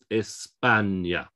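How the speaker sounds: "España" very slow, es-PAAN-ya; drawn-out "España", stress on PA, falling end; second-syllable stress. Shown as a demonstration. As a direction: break up "España", leaning long on PA. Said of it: "We have the stress on the second syllable".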